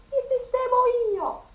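echo. A narrator's reading. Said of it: "L'eco rimandò".

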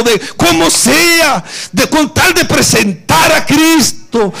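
voz alta y ardiente de predicador; predicando con fervor. Real, not acted. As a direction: exhorta a la iglesia con pasión. (predicación encendida)

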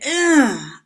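loud high groan of distaste; disgusted ugh. Retold or imitated. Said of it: "And so I said".

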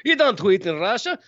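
put-on high, hammy Russian accent; bad Russian accent. Host joking that tweets tweet you, bad Russian accent. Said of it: "That was a bad Russian accent".

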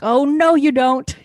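mock warning shout. Co-host retells him stopping her escape, mock warning shout. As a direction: belt it out in mock outrage.

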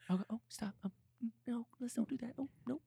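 high, soft, meek pleading; timid teacher. Retold or imitated. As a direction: plead weakly like a helpless teacher. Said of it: "And she's just".

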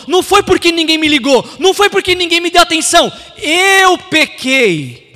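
loud, high preaching force, rising in intensity; impassioned confession. Acted. Son taking all blame for his sin, impassioned shout. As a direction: hammer each denial, stretch the admission.

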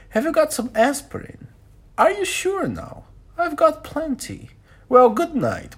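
very high, fussing lady's voice; high, solicitous old-lady character voice; kindly elderly American lady. Acted. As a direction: offer help in a high-pitched ladylike voice. Voices Mrs Hubbard in the story.

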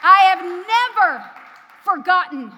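loud, slow, high preacher's cry; fiery preaching. Real, not acted. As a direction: proclaim it with all your force. Preaching peak.

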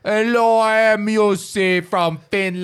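hammed-up Finnish visitor introducing himself; mock Finnish accent. Host mocks a friend's Finnish friend, in a hammed-up Finnish accent. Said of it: "your Finnish friend".

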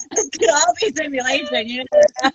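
high, laughing through the words; laughing joke. Real, not acted. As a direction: crack up while joking. Joking with laughter around it.

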